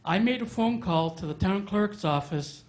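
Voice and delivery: ordinary talk, nothing marked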